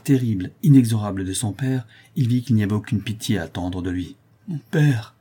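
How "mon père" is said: higher-pitched cry to a father; mild exclamation of alarm; desperate cry. A narrator's reading. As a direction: appeal to him in sudden dread. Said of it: "s'écria-t-il".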